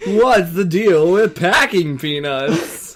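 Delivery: comedian voice. Acted. Comic mock comedian line.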